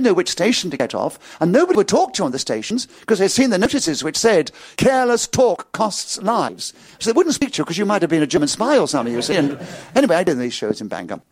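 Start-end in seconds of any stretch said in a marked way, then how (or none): 4.8-6.7 slow poster reading. Retold or imitated. Reciting the wartime poster.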